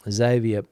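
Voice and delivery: Xavier said English-style, as "ZAY-vee-uh"; English pronunciation. Real, not acted. Streamer settling on a viewer's name, Xavier as English ZAY-vee-uh. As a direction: open Xavier on a Z, stress the first syllable. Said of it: "I think it would be".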